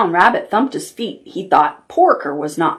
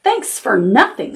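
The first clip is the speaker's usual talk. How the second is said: usual speaking voice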